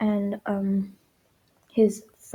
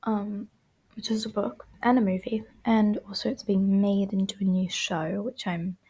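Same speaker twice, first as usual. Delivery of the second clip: ordinary delivery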